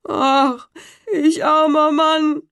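high, slow wail of a ruined carter; lamenting carter. A narrator's reading. Carter bewailing his lost horses, high lament. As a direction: cry out at losing everything.

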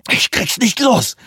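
high, slow strained gasp; panting. Acted. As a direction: struggle while speaking. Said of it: "keuchte Johansson".

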